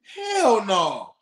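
ordinary talk

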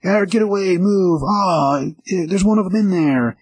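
high-pitched, hammed-up crowd alarm; mock panic. Customers fleeing the store, mock panicked cries. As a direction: imitate a fleeing crowd, high but not truly shouting. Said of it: "like, freaking out".

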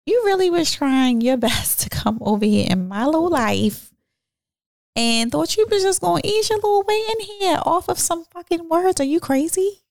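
high, mocking "are you crazy" with a laugh; mocking disbelief. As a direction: scoff at the nerve of this guy, half laughing. Mocking the caller directly.

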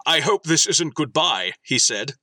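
lightly dramatic story reading; story narration. Acted. Host reading the AI story to co-hosts, lightly dramatic narration. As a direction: read it like a faintly solemn fan fiction.

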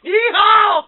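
loud, high cowboy whoop; cowboy yeehaw. Pretend. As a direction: holler like a rodeo cowboy.

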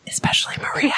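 a whispered name aside. Real, not acted. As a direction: breathe the name as a secret aside. Aside while reading letter.

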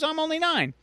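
put-on a nine-year-old pleading at his highest; kid voice. Kid repeats line, higher.

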